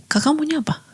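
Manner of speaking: high, soft younger-sister voice; Muriel. Acted. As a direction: lift the voice high and small to question big sister. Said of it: "pada adiknya, Muriel". (suara adik yang tinggi dan lembut)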